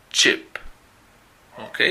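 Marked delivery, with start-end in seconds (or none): 0.1-0.6 "chip" with a short, sharp ch; English word demo. Shown as a demonstration. Teacher reading the third column word, careful demo. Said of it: "Y la tercera".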